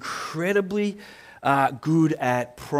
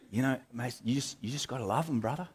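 soft, hushed, earnest friend's voice, much softer; imitating mate's soft voice; 8 dB quieter than usual. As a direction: voice your spiritual mate gently and softly. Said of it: "that's how he talks".

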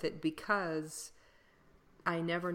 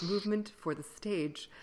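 usual speaking voice

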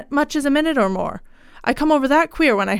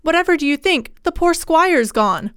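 higher-pitched voice, agitated; distressed servant, pitched up; agitated housekeeper; 6 semitones higher than usual. A narrator's reading. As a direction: break bad news in agitation, drawing out the end. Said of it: "in evident agitation".

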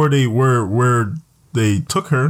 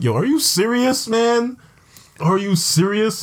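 high-pitched, incredulous yo are you serious; exasperated disbelief; 10 semitones higher, slower than usual. Retold or imitated. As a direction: cry out in disbelief at the screen. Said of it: "I'm like".

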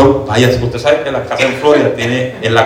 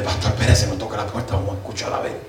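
hushed urging; 2 semitones lower, 9 dB quieter than usual. Retold or imitated. Sneaking up to listen at door.

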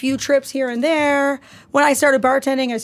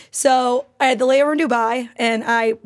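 ordinary talk

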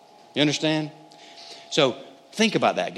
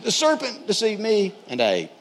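higher-pitched, mildly defensive excuse; blaming Eve; 9 semitones higher than usual. Acted. Eve answering God, defensively blaming the serpent. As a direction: pass the blame, voice pitched up. Voices Eve passing the blame on.

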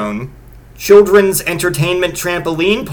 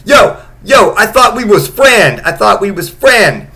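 high, loud whining yell; mock wounded yell, fading at the end; imitating a hurt teen; 6 semitones higher, 6 dB louder than usual. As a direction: keep yelling it like a wronged friend. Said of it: "the one guy just keeps going".

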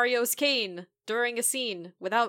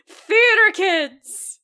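drawn-out loud high exclamation; exasperated shout; 9 semitones higher, 12 dB louder, much slower than usual. Real, not acted. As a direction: yell it with fond exasperation.

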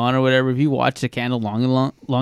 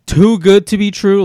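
mock disbelief; 8 semitones higher, 7 dB louder than usual. Mocking overhyped ghost stories.

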